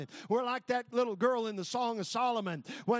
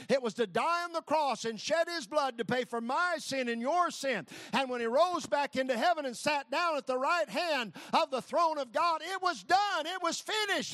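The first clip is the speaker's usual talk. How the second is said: preaching; 5 semitones higher than usual. Acted. Preaching climax on the cross.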